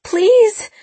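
high, loud desperate begging; begging through tears, voice breaking; tearful pleading. A narrator's reading. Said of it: "She started sobbing".

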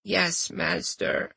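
slow, soft breathy pout; sulky whispered reply. Acted. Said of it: "she thought poutingly".

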